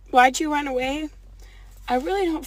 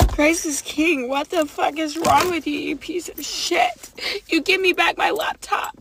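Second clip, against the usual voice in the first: higher-pitched, exasperated cursing; outraged demand; 4 semitones higher, 4 dB louder than usual. Real, not acted. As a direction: demand it back, voice raised in outrage. Demands stolen laptop back, cursing.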